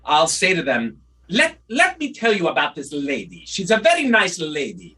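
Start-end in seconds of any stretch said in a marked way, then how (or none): 1.3-5.0 doctor imitated in a Russian-sounding accent; mimicked Russian-accented general doctor; Russian or Prussian accent. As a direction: put on an old-world Russian or Prussian accent. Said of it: "I could do that doctor really well".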